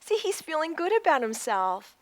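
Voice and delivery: ordinary delivery, nothing marked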